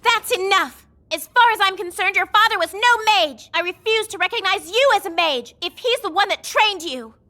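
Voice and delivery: loud, high, angry denunciation; mad outburst. Acted. Mage rejecting her partner's father as a teacher, angry. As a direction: raise your voice and angrily refuse to accept it. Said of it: "Why are you getting mad?".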